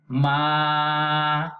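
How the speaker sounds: Ma held long and steady; long vowel. Shown as a demonstration. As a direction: hold Ma long. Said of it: "dibaca panjang". (Ma dibaca panjang)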